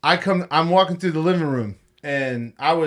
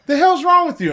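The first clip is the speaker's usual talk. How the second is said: normal talking voice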